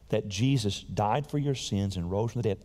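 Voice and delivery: ordinary talk, nothing marked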